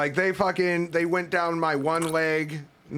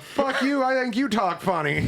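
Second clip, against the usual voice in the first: higher, mock-indignant comeback; retold angry comeback; 8 semitones higher than usual. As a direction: fire it back at the cop, mock-offended. Snapping back at the cops.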